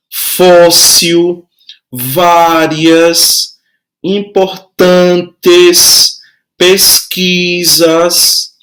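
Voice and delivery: slow, drawn-out word-by-word reading with the second-to-last syllable held; penultimate syllable stressed. Shown as a demonstration. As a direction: stretch "crânio", "antigo", "fóssil" on their next-to-last syllables. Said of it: "é a mais forte".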